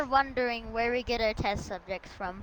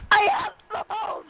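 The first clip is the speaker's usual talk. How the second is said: high triumphant yell; gleeful shout; 10 semitones higher, 5 dB louder than usual. Pretend. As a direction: crow with delight over a bone.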